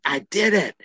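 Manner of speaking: high, victorious "I did it"; triumphant cry. Retold or imitated. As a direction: shout it like a winner. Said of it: "with Victor Jean".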